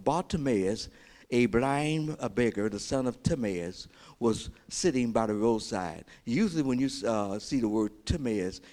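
ordinary talk, nothing marked

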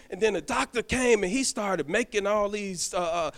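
ordinary talk, nothing marked

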